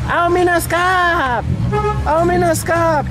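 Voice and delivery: high, slow drawn-out vendor cry; street vendor call. Retold or imitated. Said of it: "às vezes a gente tá andando no meio da avenida".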